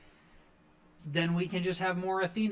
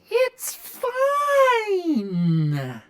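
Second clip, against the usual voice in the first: much higher and far louder, a crying shriek on "It's fine."; demonic scream; 9 dB louder than usual. Retold or imitated. The turned-evil Lio Rush, red-eyed, to the camera, in a screaming demonic cry. And he just goes.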